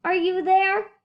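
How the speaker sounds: high, loud call into the clover. A narrator's reading. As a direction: call out searching.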